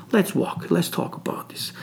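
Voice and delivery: hushed, softly acted invitation; confidential coaxing. Shapur coaxes Arshak confidentially.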